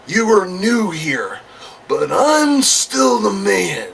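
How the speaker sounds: slow, drawn-out wrestler boasting with swooping high pitch; Randy Savage promo. Acted. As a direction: stretch each phrase into a cocky threat. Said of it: "Randy Savage would cut a promo".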